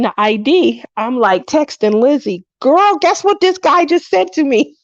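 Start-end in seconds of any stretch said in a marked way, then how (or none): 2.6-4.8 put-on high-pitched excited gushing to a girlfriend; ecstatic. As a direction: act out bursting with juicy news, pitched way up. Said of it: "so ecstatic by what he said".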